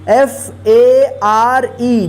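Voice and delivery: letters spelled one by one, very slow; spelling out letters. Shown as a demonstration. Teacher spelling a word aloud slowly. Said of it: "यहाँ पे भी आर ई है".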